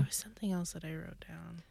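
unvoiced hushed aside; whisper. Real, not acted. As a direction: breathe it out under your breath.

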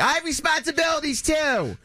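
high whiny voice, building to a shrill shout; high mock shout. [name] mimics the president shouting that she needs to talk. Board president yelling on phone.

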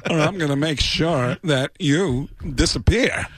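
a hint of Walken's menace, measured and cold; Christopher Walken impression. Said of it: "he stands up and goes".

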